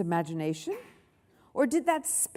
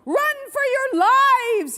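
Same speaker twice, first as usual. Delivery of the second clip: very high, loud cry of alarm; panicked yelp; 8 dB louder than usual. A narrator's reading. Gordon warning the classes, panicked yelp. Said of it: "yelped Goody Goody Gordon".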